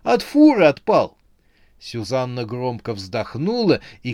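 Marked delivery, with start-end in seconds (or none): 0.0-1.2 high, loud and triumphant; joyful exclamation. A narrator's reading. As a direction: blurt the excuse out gleefully. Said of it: "радостно воскликнул он". (радостно выкрикивая)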